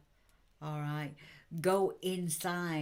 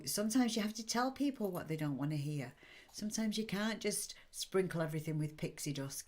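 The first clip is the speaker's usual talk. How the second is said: ordinary delivery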